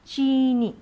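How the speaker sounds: चीनी with a long, stressed ची; long vowel demo. Shown as a demonstration. Teacher models for children: चीनी with long ची. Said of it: "इसे हमें बनाना क्या है".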